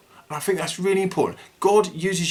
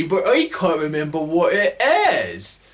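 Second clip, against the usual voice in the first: ordinary delivery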